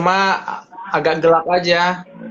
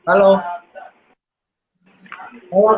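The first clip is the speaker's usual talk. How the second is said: calling out; 5 semitones higher, faster than usual. Real, not acted. Checking if connection works.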